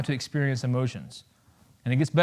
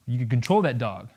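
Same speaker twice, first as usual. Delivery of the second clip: usual speaking voice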